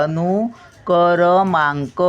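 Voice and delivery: अनुक्रमांक slowly, क्र joined, र with its vowel; slow demonstration. Shown as a demonstration. Teacher asking pupils to attend, slow model word. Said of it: "आता इथे लक्ष द्या".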